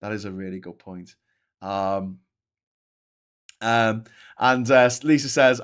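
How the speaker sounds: ordinary talk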